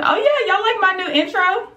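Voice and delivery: high, suddenly remembering something; sudden realization. Real, not acted. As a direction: jump up in pitch, just remembered. Suddenly remembering her new intro.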